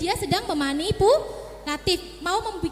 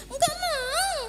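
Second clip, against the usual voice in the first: suara anak tinggi merengek setengah menangis; anak menolak; 10 semitones higher, slower than usual. Retold or imitated. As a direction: tolak seperti balita yang rewel. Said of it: "Mesti kan gitu". (rengekan anak yang menolak)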